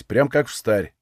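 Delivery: plain reading voice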